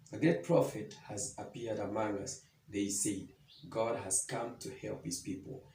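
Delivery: soft, low Bible reading; hushed reading of the Gospel; scripture reading. Acted. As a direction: read the passage quietly, like a lesson. Scripture reading before the sermon.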